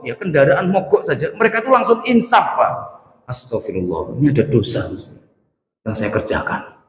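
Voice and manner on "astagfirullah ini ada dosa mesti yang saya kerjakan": lower, softer pious murmur; quietly repent, as if catching your own sin; humble realization. Retold or imitated. Pious forefather murmuring repentance as his motorbike stalls.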